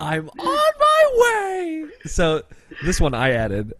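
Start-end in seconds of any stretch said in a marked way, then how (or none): 0.0-1.9 put-on high, slow shouted child voice; little kid's voice. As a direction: cry it out like a whiny little kid. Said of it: "in, like, this little kid's voice".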